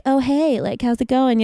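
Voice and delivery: low, sultry customer-service voice; sexy call center voice. Retold or imitated. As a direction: purr it like a call center flirt. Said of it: "your voice sounded so different right there".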